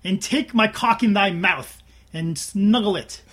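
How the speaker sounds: booming, slow mock master's command; hammed-up domineering master voice; slow theatrical villain menace.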